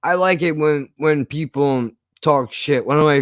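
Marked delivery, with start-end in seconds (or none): none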